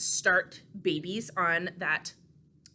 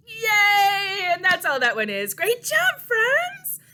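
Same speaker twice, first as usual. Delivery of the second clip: excited praise to toddlers; cheering; 11 semitones higher, 10 dB louder, slower than usual. Real, not acted. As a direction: celebrate big. Cheering the little ones.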